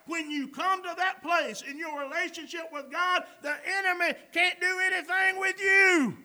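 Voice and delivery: loud, high, fervent preaching; preaching at full force. Real, not acted. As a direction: build to a defiant shout, stretching key words.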